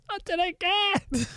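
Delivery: high, soft, drawn-out mimicry through giggles; laughing imitation. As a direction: mimic the co-host while cracking up. Laughingly repeats co-host's words.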